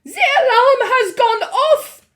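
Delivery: very high, loud slow shriek; witch shrieking. A narrator's reading. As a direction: shriek in triumph. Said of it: "shrieked the Grand High Witch".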